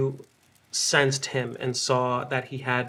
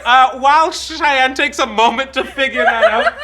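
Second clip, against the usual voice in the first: talking through giggles; can't stop laughing while talking; 10 semitones higher, 8 dB louder than usual. Real, not acted. GM laughing after the popcorn mishap.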